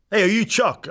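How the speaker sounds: a stranger calling out from the far end of a bar; retold yell across the bar. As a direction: call out across the room to a stranger. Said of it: "The guy yells from the other side".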